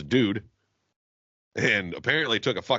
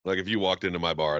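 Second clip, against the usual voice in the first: usual speaking voice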